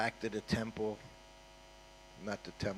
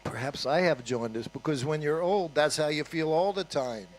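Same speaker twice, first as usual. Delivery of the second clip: louder, wry joke, driving home the punchline; joking; 5 semitones higher, 8 dB louder than usual. Retold or imitated. Teacher jokes to sick servant, louder and wry. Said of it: "It was a joke".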